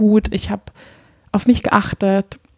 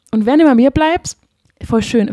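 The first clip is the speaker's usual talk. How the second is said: ordinary talk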